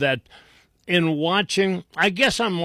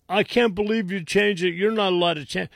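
higher-pitched whiny objection; mock whining; 5 semitones higher, faster than usual. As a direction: protest like a sore loser. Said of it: "stop your whining".

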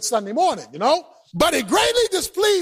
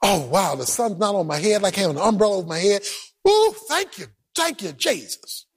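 low, relaxed mock sigh of relief; lightly voiced relaxed Jonah; contented Jonah; 6 semitones lower than usual. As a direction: settle in like he's chilling in the shade. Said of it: "He's sitting out there just".

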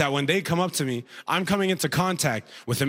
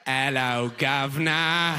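hammed-up cockney greeting; mock British accent; slower than usual. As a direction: parrot a British accent for fun. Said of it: "They're just making sounds you like".

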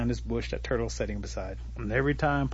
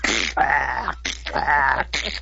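loud, harsh imitated choking; repeated strangled hacking noises; choking gags; 3 semitones higher, 5 dB louder than usual. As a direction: gag as if a stick is caught in your throat. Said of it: "caught in his throat and goes".

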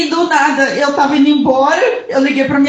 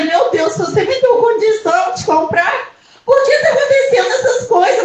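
desperate retold prayer breaking into tears; retold tearful cry to God; 6 semitones higher than usual. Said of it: "ó, chorando".

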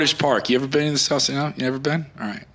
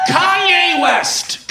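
hype shout; 11 semitones higher, much slower than usual. Acted. Comic hyping the crowd, shouting the name slowly. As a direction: stretch the name out like a hype man.

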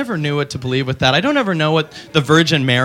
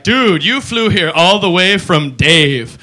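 slow dude voice; 2 semitones higher, much slower than usual. Retold or imitated. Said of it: "We're gonna be like".

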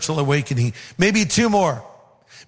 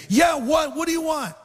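much higher-pitched, irritated retort; mock irritation; 10 semitones higher than usual. Street preacher answers the pastor who interrupted him, high-pitched mock irritation. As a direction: imitate someone annoyed at being interrupted, pitched way up.

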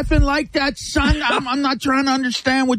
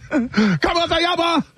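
a hint of the movie line's Spanish accent, a touch higher; movie-quote Spanish impression; 6 semitones higher than usual. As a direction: toss off the movie line in a put-on Spanish accent. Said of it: "how my man said it in forty-year-old virgin".

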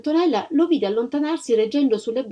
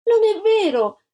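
put-on high, loud child's protest; indignant cry; 12 semitones higher, 5 dB louder than usual. A narrator's reading. Antonella denies Ignazia's accusation, indignant cry. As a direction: cry out against the lie. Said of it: "gridò Antonella".

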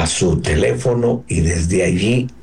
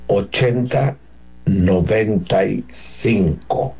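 card number with long pauses between groups; one group of digits at a time, waiting after each; slowed-down repeat. Real, not acted. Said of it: "Lo dije muy rápido, ¿no?".

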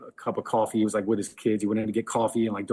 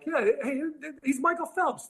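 much higher, sure of himself, pushy fan; a fan who won't drop it; insistent customer voice. Retold or imitated. Customer insisting to Eli he's Phelps, high eager voice.

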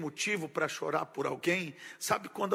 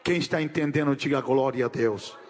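dropped low, asking for an amen; preacher's low call for a response; call to the congregation; 5 semitones lower, faster than usual. Acted. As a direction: throw it to the crowd to answer.

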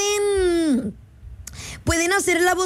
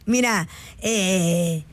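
normal talking voice

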